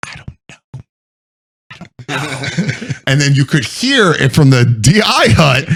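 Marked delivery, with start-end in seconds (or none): 0.1-2.9 very soft whisper, slowing down; whispered bewilderment. Acted. Crushed buddy answering after lights out, bewildered whisper. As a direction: whisper it, drawing out the repeat.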